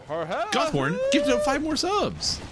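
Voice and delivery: high, drawn-out cheer for the gift; excited announcement. Real, not acted. Streamer celebrating gifted subs from a viewer, excited.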